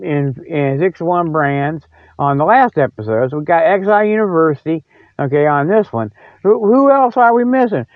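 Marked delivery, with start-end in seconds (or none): none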